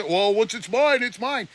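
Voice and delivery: mocking a scammer. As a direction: sneer it like a greedy scammer. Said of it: "That's what scammers say".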